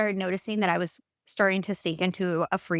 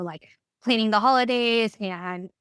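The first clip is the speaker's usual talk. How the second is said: ordinary talk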